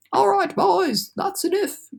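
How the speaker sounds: very high, slow calling voice; shouting cloudman. Acted. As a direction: call out to the crew from afar. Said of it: "they heard him shouting".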